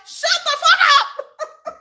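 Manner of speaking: high slow mock outburst with laughter; mock yell. Answering the whiny vlogger, high slow laughing yell. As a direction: stretch out the shout, laughing. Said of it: "I'm like".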